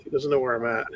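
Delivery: ordinary delivery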